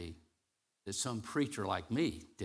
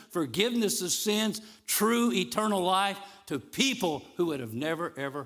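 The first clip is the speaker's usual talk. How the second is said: raised, ringing sermon delivery with stretched key words; full-force preaching; 5 semitones higher, 7 dB louder than usual. Acted. Preacher proclaiming God's glory to the congregation, full-force preaching. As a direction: proclaim it higher and louder.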